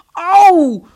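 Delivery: high loud yelp of pain; sharp ouch. Acted. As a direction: cry out as if pinched hard. Esther pinches him.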